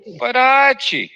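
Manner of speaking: shrill high whine on Archie; Edith Bunker voice. Retold or imitated. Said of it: "Her voice".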